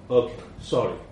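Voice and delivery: ordinary delivery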